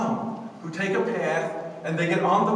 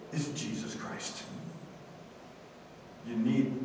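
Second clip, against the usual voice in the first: ordinary talk, nothing marked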